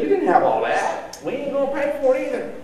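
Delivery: high-pitched mocking insurance voice; mock refusal. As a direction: imitate an insurer turning down a claim, pitch jumping up. Acted insurance company refusing a claim.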